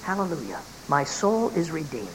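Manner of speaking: hushed, joyful words close to his ear; low secret joy. Retold or imitated. To the old man who loved him, a hushed joyful confession. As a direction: keep it down, bursting with joy. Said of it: "said in his ear as low as I could".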